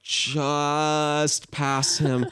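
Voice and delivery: very slow, drawn-out delivery; tense creeping. Retold or imitated. Player just passing the monster, slow creeping. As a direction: stretch the words out as if inching by.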